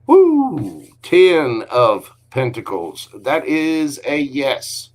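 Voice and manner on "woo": high, loud "woo" sliding down; excited whoop. Real, not acted. Tarot reader cheering a lucky card, excited whoop. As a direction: let out a big, high whoop.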